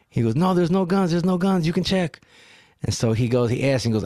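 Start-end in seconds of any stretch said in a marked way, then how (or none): 0.4-2.2 high, imitated protest; mock-flustered high denial; anxious denial. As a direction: act out a driver pleading innocence, pitched up. Acts the driver denying guns.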